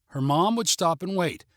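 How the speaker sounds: ordinary delivery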